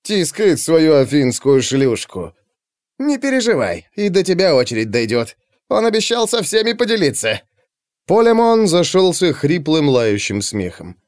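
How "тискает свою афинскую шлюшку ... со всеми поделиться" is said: jeering crony. Acted. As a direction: play a coarse crony mocking his mate. Crony gossips coarsely, then laughs.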